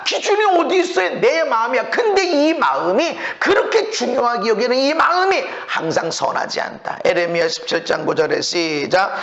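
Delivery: ordinary talk, nothing marked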